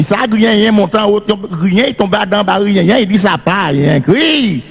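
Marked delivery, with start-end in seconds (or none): 4.0-4.6 called out cric. Acted. Storyteller calls out to audience.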